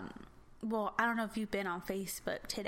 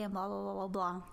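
usual speaking voice

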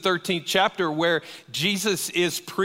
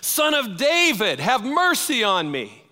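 much higher and louder desperate cry; yelling even louder; 8 semitones higher, 5 dB louder than usual. Retold or imitated. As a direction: shout it out so the passing rabbi must hear. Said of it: "He starts yelling even louder".

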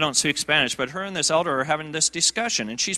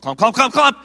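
higher, louder thumping clumps; imitated walker clumps; 7 semitones higher, 8 dB louder than usual. Preacher mimicking the crippled woman's walker closing in, loud thumps. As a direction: stamp out the walker getting closer. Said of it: "I hear this bizarre sound".

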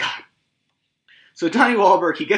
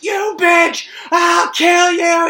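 much higher, much louder furious shout, the second part slow; 11 dB louder, much slower than usual. Retold or imitated. Podcaster acting out a chained man screaming at [name], furious shout. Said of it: "we hear [name] screaming at Amanda Young".

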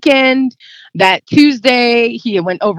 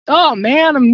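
a touch of tears, pushing on quickly; holding back tears mid-story; choked up; 5 semitones higher than usual. Real, not acted. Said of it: "I'm a little bit verklempt".

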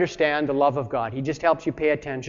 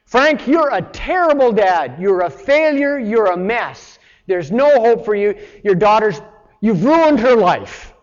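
higher and louder, slow hammering accusation; the devil accusing; 4 semitones higher, 5 dB louder than usual. Retold or imitated. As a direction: voice the devil tearing Frank down. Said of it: "When the devil works on you, he says".

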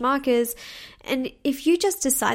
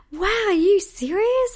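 high, drawn-out, louder retold amazement; slow wide-eyed wow, pitched way up; amazed reaction; 10 semitones higher, 5 dB louder, slower than usual. Imitating saleswoman hearing about the coaching job, amazed.

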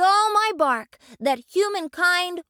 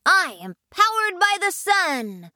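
much higher, sure declaration; with conviction; 12 semitones higher than usual. Acted. Son answering his homework question with conviction. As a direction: announce it proudly. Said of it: "with conviction".

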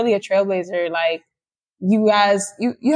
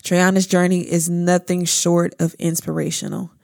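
smooth, measured ad read; promo voiceover; 3 semitones lower than usual. Acted. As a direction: read it like a heartfelt promo announcement. Promo voiceover introducing the guest.

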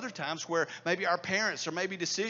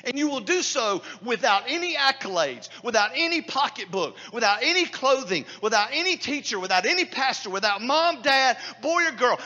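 louder, ringing pulpit preaching; preaching at full force; 4 semitones higher, 9 dB louder than usual. Acted. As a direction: hammer each "without any" home to the congregation.

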